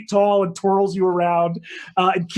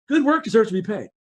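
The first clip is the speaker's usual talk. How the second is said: quick, brisk royal praise; fast and businesslike; brisk queen; 4 semitones higher, 3 dB louder, much faster than usual. Acted. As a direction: rattle it off briskly like a busy queen. Queen of Midday pays.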